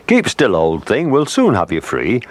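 high-pitched storybook fish; swordfish character. A narrator's reading. Swordfish reassures the trapped whale.

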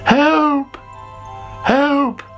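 high-pitched cry of alarm; acted distress call, very high; calling for help. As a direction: call out in storybook panic. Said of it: "called Sammy".

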